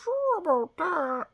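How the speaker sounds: very high, slow doubtful frog voice; high skeptical frog voice. Pretend. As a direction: voice the frog's doubt, squeaky. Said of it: "looking over at her like".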